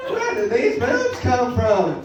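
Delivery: high, drawn-out observational-comic question; Jerry Seinfeld. Acted. Comic puzzling over new body, as a Seinfeld impression. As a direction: stretch it out like a baffled stand-up. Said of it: "I can do Seinfeld too".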